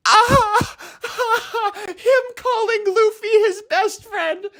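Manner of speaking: high, wailing fan overwhelmed with emotion; real choked-up squeal; on the verge of tears. Real, not acted. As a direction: wail it tearfully, pitch way up. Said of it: "I'm gonna cry again".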